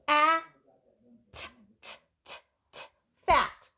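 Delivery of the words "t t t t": soft voiceless t, t, t; isolated t sounds. Shown as a demonstration. Teacher sounding out a letter, soft and breathless. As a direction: tap out the t sound softly for kids. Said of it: "What letter says t?".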